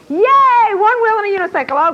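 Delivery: loud high cheer; cheering yay. Real, not acted. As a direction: cheer the right answer. Student got answer right.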